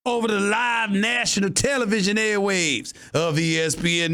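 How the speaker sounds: mock cowboy accent for Dallas fans. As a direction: play a brash Texas cowboy fan gloating, then mocking. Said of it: "with my regular cowboy accent".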